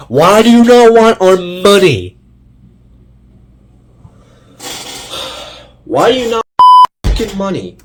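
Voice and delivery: loud, high, frustrated shouting; real exasperated outburst; angry rant. Real, not acted. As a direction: yell at the studio in real frustration. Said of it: "I'm sorry if I am a little angry".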